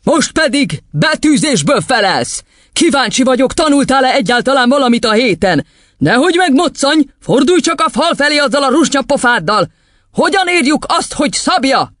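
high, hectoring schoolmistress; tyrant headmistress, demanding. Acted. Headmistress testing a boy on spelling, hectoring. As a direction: order the pupil around in a high, strained voice.

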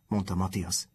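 usual speaking voice

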